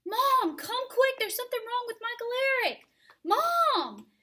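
put-on high, loud child screaming for mom; boy screaming downstairs for his mother, high and loud; panicked scream. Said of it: "Daniel screams downstairs".

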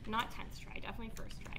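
breathy whispered aside; whispering. Real, not acted. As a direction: whisper it to yourself. Whispered aside about card swipe.

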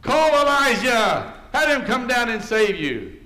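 loud, high jeering of bystanders; mocking shout. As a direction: taunt him at full voice. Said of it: "they're making fun of him".

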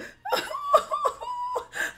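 very high squealing laugh; giddy, teary high-pitched laughter; overwhelmed high laugh. Real, not acted. Overwhelmed by the surprise gift.

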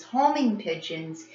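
ordinary delivery, nothing marked

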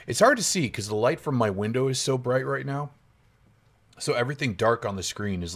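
ordinary delivery

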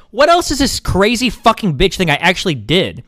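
incredulous, worked-up rant with big pitch jumps; exasperated outburst. Real, not acted. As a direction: demand answers, voice climbing in real exasperation. Upset at being accused.

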